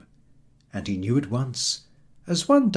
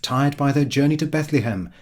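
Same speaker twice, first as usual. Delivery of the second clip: usual speaking voice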